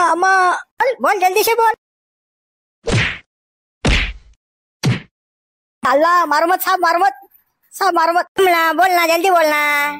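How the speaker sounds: high-pitched cries begging for mercy; acted panicky pleading; pleading under beating. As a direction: beg them to stop hitting. Suspect pleading while being beaten.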